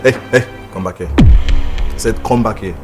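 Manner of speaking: stern, rising command to come back; commanding shout. Acted. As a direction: order her back, loudly. Husband stops leaving wife.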